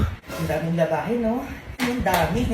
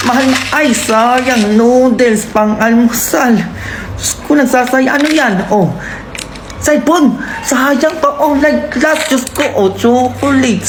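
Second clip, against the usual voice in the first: loud, slow, exasperated nagging; loud scolding rant; 10 dB louder, slower than usual. Acted. Mother loudly rants over wasted food and phone. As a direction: scold every wasted thing at full volume.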